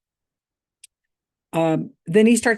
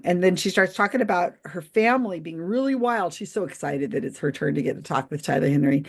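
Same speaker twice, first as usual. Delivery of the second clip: normal talking voice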